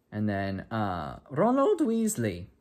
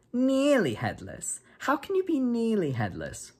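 much higher, slow, puzzled voice; curious first-year; 12 semitones higher, slower than usual. Acted. First-year asks the ghost how he is nearly headless, high and puzzled. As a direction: wonder aloud, baffled.

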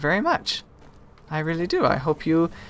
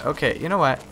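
usual speaking voice

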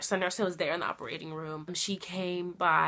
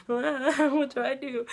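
slow, raised helpless wail; mock helplessness; 6 semitones higher, 4 dB louder, slower than usual. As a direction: act out being at a total loss. Acts out her helpless reaction.

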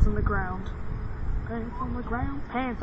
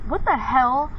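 usual speaking voice

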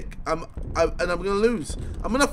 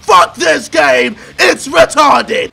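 loud, hammed-up angry bellowing; rage mode; 7 dB louder than usual. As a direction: roar like an over-the-top angry strongman. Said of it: "bring on rage mode".

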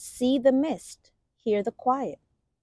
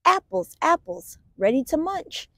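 excited; 8 semitones higher than usual. Acted. Excited reading of apple page.

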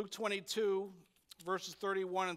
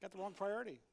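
gentle correction; 7 semitones lower, 6 dB quieter, faster than usual. Retold or imitated. Jesus gently correcting Martha, soft and low. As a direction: lower and softer, kind correction. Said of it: "He's gently telling Martha".

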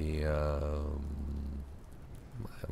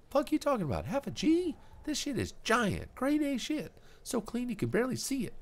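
put-on much higher, loud offended punk kid; outraged Kuno; 3 dB louder than usual. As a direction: squeal it out, insulted.

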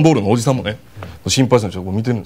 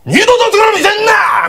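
furious yell; 4 dB louder than usual. Retold or imitated. Man yelling at girlfriend.

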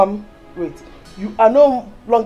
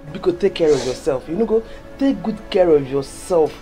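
low, soft counsel; earnest motherly advice; 11 semitones lower, 7 dB quieter than usual. Acted. Mother advising daughter to take care in the city, tender. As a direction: advise gently in a low, hushed register.

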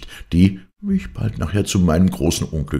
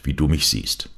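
plain reading voice

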